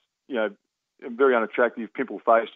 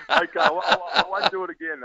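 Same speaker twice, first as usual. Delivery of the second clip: high-pitched, still giggling; laughing; 10 semitones higher than usual. Real, not acted. Player giving up on his mocked accent, laughing. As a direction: promise it through leftover laughter.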